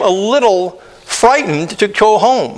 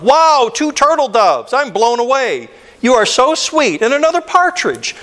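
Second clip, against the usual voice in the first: much higher, gushing with delight; thrilled; 6 semitones higher than usual. Acted. Letter writer thanking her true love, gushing and high. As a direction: gush over the gift like a giddy fan.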